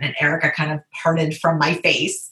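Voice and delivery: ordinary talk, nothing marked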